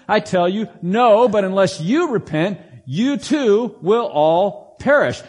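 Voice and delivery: scripture read out with full, ringing force; preached scripture reading. Acted. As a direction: proclaim it like a preacher from the pulpit. Pastor reads Jesus's words aloud.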